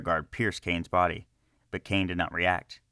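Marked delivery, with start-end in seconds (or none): none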